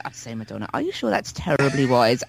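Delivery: a light hint of a polite, fretful old English gent. Podcaster voicing Sergeant Wilson fretting at [name], lightly done. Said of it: "it turns me into Sergeant Wilson".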